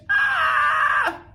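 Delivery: long, loud, very high squeal. Shown as a demonstration. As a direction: hold a shrieking high "ah". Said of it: "getting a higher pitch".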